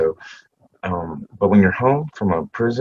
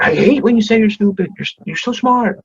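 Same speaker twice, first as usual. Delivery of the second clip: higher, louder impassioned boss; Keith's voice; 5 dB louder than usual. Retold or imitated. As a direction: unload on him with high urgency. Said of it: "his very Keith way".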